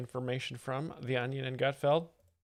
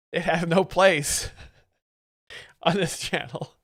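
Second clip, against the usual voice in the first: higher, louder, words broken by laughter; laughing; 4 semitones higher, 6 dB louder, slower than usual. Real, not acted. Streamer rejecting a risky submission on this channel, laughing. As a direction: crack up while ruling it out.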